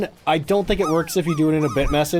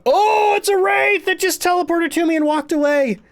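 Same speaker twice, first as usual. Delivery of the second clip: sudden excited exclamation, pitched way up; surprised; 13 semitones higher, 3 dB louder than usual. Real, not acted. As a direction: blurt it out in real surprise. Ghost suddenly teleports to her.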